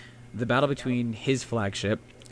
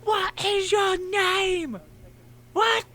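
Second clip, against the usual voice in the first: very high, slow, drawn-out meme shriek; high shrill meme voice shouting across the deck; squeaky meme voice; much slower than usual. Retold or imitated. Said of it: "So I'm just picturing".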